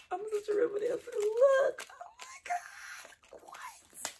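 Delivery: very high squeal fading to a whisper; squeal, then lose your voice in amazement; thrilled disbelief. Real, not acted. Thrilled at seeing a gift.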